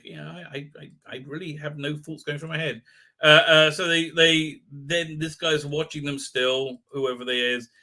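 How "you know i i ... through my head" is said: much softer, blank-minded mumble; mock vacant murmur. Vlogger mocking Yaz's emptiness, soft vacant murmur. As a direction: sound like nothing is going on upstairs. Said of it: "Yaz is like".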